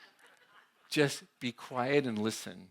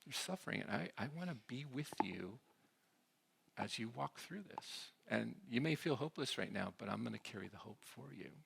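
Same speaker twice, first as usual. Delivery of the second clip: soft, gentle words to someone suffering; empathic listener; 10 dB quieter than usual. Shown as a demonstration. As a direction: speak quietly as if sitting beside someone in pain. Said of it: "but just really understand".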